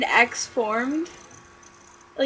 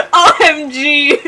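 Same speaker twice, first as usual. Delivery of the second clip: laughing; 6 semitones higher, 10 dB louder, slower than usual. Real, not acted. Reader cracking up mid-line.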